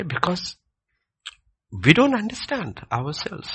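high, loud fervent preaching; preaching at full force. Real, not acted. Preacher driving his point home to the congregation, fervent and loud.

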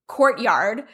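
usual speaking voice

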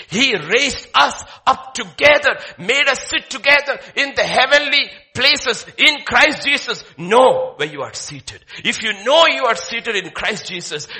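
preaching at full force. Acted. Pastor loudly proclaiming Ephesians to the congregation. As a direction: ring the verse out to the hall.

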